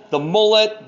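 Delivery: usual speaking voice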